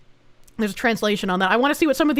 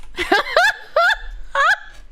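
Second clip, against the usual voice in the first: high laugh. Real, not acted.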